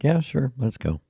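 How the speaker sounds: a quick, high, hammed-up yes; eager yes. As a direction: jump at the offer, playing it up. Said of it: "And I'm like".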